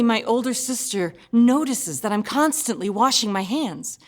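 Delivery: ordinary delivery, nothing marked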